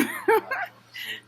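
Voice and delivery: high burst of laughter. Real, not acted. Vlogger laughing at her comeback to the manager. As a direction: cackle at her own comeback.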